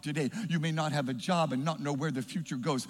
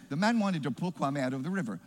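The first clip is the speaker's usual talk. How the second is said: ordinary delivery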